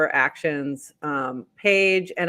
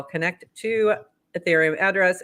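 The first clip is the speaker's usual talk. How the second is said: normal talking voice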